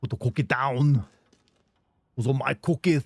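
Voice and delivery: another try at the Arnold cookie line; rusty Austrian accent, out of practice. Retold or imitated. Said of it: "do this voice so much better".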